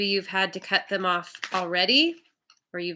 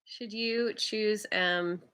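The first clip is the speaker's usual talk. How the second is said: much softer and slower, to herself; quiet musing repeat; 9 dB quieter, slower than usual. Real, not acted. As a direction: mull the question over under your breath. Repeats the question while drawing a card.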